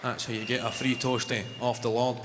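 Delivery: ordinary talk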